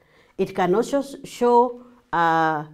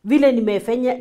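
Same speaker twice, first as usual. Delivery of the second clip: ordinary delivery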